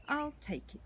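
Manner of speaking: put-on bright, high little girl; young girl voice. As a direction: lift it up into a child's voice. Millie decides to buy.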